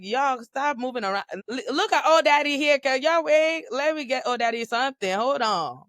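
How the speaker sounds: mock hollering at the wedding guests to hold on; calling out to the crowd.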